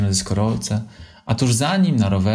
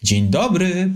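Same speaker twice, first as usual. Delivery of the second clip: joyful greeting; 10 semitones higher, slower than usual. A narrator's reading. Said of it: "powitała ich z radością".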